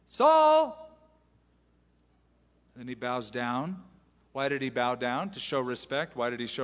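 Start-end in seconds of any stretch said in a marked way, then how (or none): 0.1-0.9 much higher, loud cry of "Saul!"; shouted call. Acted. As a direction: call him across the valley. David calls out from cave.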